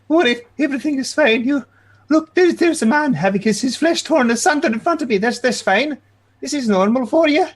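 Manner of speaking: put-on high, indignant old-lady voice. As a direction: protest shrilly in a fussy old woman voice. Old lady character arguing with guard.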